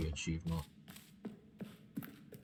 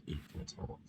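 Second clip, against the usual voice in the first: whisper; 14 dB quieter, much faster than usual. Acted. Whispered reading.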